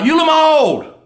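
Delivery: loud, high panicked yell. Acted. Husband in the burning tub yells for his wife, loud and high. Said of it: "he yelled out".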